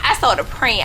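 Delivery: normal talking voice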